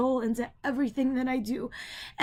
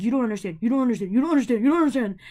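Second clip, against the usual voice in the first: imitating dad, getting louder each repeat; mock dad building; 6 dB louder, much faster than usual. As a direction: hammer it harder every time. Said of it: "My dad's just like".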